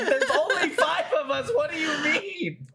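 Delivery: high-pitched protest through laughter; dying laughing. Retold or imitated. Retelling friends arguing with a stoned teammate, high and laughing. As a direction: crack up while protesting. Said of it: "are fucking dying 'cause we're like".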